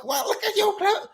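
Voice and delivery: high sneering mock of her insult. Father imitating the mother's jab at his clothes, sneering. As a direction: mimic her snooty jab, pitched high.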